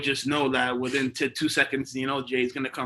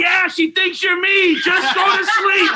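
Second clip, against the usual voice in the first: high, loud, slow, laughing; laughing Jay imitation; 15 dB louder, slower than usual. Jay telling him to sleep, laughing slowly. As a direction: barely get it out for laughing. Jay's reply through laughter.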